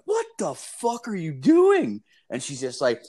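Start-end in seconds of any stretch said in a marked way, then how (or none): 0.0-2.0 high-pitched, drawn-out disbelief; shocked and incredulous, pitch leaping way up; shocked outburst. Retold or imitated. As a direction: retell it as a stunned, stretched-out squeal. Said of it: "I was in shock".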